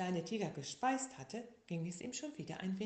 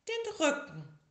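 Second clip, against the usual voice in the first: bossy sick tiger; 11 semitones higher, 9 dB louder than usual. Acted. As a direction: give orders like a patient. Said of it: "sagte der kleine Tiger".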